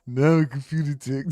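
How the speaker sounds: words pushed through clenched teeth; through gritted teeth. Retold or imitated. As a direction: keep the jaw shut as you say it.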